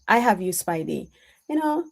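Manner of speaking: usual speaking voice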